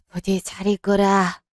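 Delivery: ordinary talk, nothing marked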